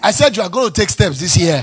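loud, sung-out shout; shouted repeat. Real, not acted. Pastor repeating to a congregation that didn't hear, shouted. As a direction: belt it so they finally hear. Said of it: "you didn't hear me".

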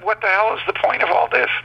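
very high, fast incredulous question; retold exasperation; exasperated disbelief. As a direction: demand the sense of it, voice shooting up.

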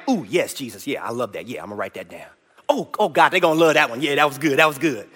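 low, soft, fawning apostle muttering along; mock eager John. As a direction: play John as a sycophant taking dictation, low and soft. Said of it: "And he was like".

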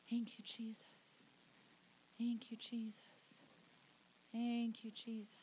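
hushed, whispered thanks; very soft, reverent whisper; whispered prayer. Real, not acted. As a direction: whisper it privately in worship.

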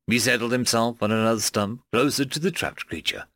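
ordinary delivery

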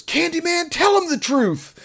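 high, loud call at the screen; mock heckle. Friend Alex shouting at Candyman on screen, high loud mock call. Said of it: "jumps up and goes".

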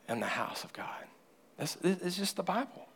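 hushed whisper; whispered for emphasis. Real, not acted. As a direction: drop the line to a breathy whisper. Preacher drops to a whisper.

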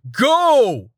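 a sharp high command yell; shout. Acted. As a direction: bark the order to launch. Captain shouts order on ship.

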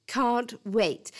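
usual speaking voice

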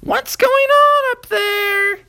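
very high, loud, slow mom calling upstairs; a mother calling up the stairs; high shrill-free call, drawn out and loud. A narrator's reading.

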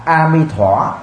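A Mi Thỏa said "a-mi-tho", Chinese style; Chinese pronunciation. Shown as a demonstration. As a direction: give A Mi Thỏa its Chinese sound. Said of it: "Người Trung Quốc á". (A Mi Thỏa đọc kiểu người Trung Quốc)

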